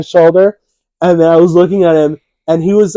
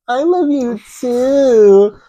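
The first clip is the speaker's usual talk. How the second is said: put-on slow, high child's reply; kid voice; 9 semitones higher, much slower than usual. Nephew answering the uncle's affection, slow and high kid voice. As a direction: drawl it like a little kid. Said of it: "He's like".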